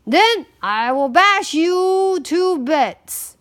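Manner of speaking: loud, slow, defiant big goat; great big voice. A narrator's reading. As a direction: boom the threat out slowly. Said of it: "in his great big voice".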